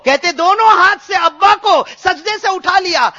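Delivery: high, loud, impassioned shout-preaching; preaching at a shouting climax. Acted. Preacher reaching the climax of the story, shouting fervently. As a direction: cry it out to the hall.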